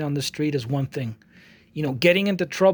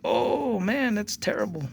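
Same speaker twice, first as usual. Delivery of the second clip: mock dismay; 6 semitones higher, slower than usual. Reaction to street trouble.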